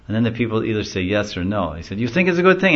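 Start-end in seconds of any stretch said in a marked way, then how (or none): 2.0-2.7 high, loud teasing question; goading charioteer. Retold or imitated. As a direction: egg the crowd on. Charioteer goads crowd.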